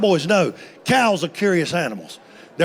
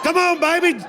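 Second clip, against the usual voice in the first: high, excited coaching yells; coaching shout; 8 semitones higher than usual. Retold or imitated. Husband shouting fishing tips to wife fighting the cow, high excited yell. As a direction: cheer her on like a fishing coach.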